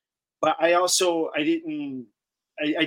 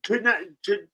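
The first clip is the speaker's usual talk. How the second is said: stumbling and restarting, with long pauses; halting, flustered false starts. Real, not acted. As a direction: lose the thread and grope for the words. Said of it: "I'm getting flustered here".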